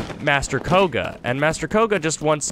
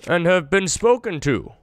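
higher, theatrical evil-cultist voice; mock-sinister cultist monologue, a touch higher; hammed-up Yiga villain; 6 semitones higher than usual. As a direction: ham up a cheerfully evil villain reveal. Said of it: "I don't know why I speak like this".

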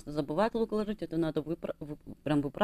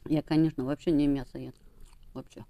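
eating shellfish while speaking; talking while chewing. Real, not acted. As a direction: mouth partly full. Seen 3: Keeps putting small shellfish in her mouth and chewing while talking.

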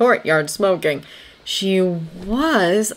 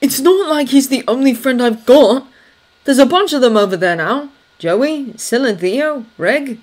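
higher, louder defensive retort; cross; 8 semitones higher, 7 dB louder than usual. A narrator's reading. Thomas listing his friends to Anna, cross. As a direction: answer back crossly, voice raised. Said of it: "said crossly".

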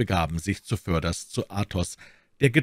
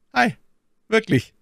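high, louder exclamation; exclaimed surprise; 5 dB louder than usual. A narrator's reading. D'Artagnan doubting his friend's story, exclaiming in mock surprise. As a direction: call it out as a surprised question. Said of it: "rief D'Artagnan".

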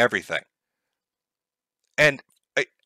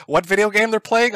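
usual speaking voice

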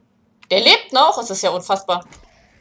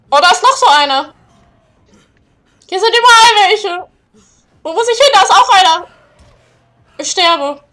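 high, loud frightened cries; panicked yelling; 7 semitones higher, 11 dB louder than usual. Real, not acted. Gamer sees monsters everywhere, shouting in panic. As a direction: yell as monsters close in.

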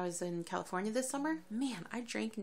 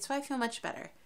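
ordinary talk

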